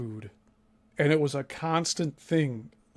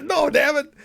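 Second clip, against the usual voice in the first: high, loud blurt; hammed-up warning; mock outburst; 9 semitones higher, 3 dB louder than usual. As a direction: burst out to warn her. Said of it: "Susie speaks up and is like".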